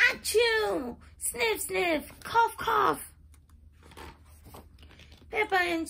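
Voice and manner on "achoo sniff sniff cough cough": high mock sneeze, sniffs and coughs; sneezing and coughing. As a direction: play a pony with a cold. Sick pony sneezing again.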